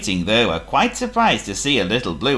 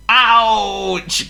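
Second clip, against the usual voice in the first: high, loud yelp; trucks crying out; 12 semitones higher, 5 dB louder than usual. Acted. Trucks yelping as Thomas bumps them. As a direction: yelp at the bump. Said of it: "cried the trucks".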